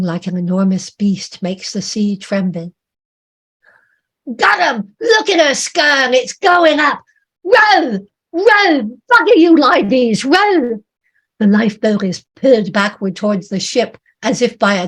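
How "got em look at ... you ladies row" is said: very high, loud yells to row; frantic shouting. Acted. Sailor yelling at the women as the stern rises, frantic shout. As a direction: scream orders in terror.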